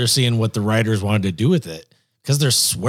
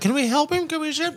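worried fan voice; 4 dB quieter than usual. Retold or imitated. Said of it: "People will be listening, like".

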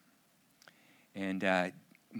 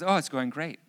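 higher, louder cheerful answer; upbeat report; 6 semitones higher, 8 dB louder than usual. Retold or imitated. Daughter tells dad gym class is fine now, upbeat. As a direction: imitate a pleased daughter.

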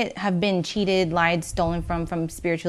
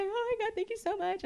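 much higher, tearful thank you; imitating crying; 4 dB quieter than usual. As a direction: mimic a grateful client near tears.

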